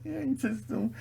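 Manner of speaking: high, helpless laugh through the words; real giggling breakdown. Real, not acted. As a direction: crack up while trying to talk. Cracks up mid-rant.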